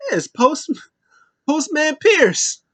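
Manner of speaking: sing-song it high and giggle on the name; giggly creepy greeting. Retold or imitated. Bray greets postman in recap.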